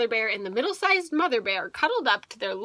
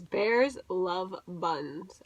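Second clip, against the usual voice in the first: very slow, drawn-out reading of the tab; slow reading aloud; 4 semitones lower, 5 dB quieter, much slower than usual. Acted. Parent reading the flap slowly to a child. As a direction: read each word slowly to the child.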